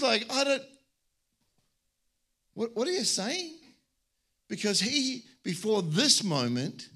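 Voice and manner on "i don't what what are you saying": high, bewildered question; shocked. Retold or imitated. Preacher voicing God, stunned by the centurion's faith, surprised. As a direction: voice God caught off guard. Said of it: "he was just shocked".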